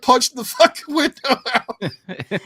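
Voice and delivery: ordinary delivery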